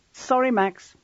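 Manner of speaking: high-pitched, firm official refusal; hard, professional. A narrator's reading. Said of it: "her voice hard".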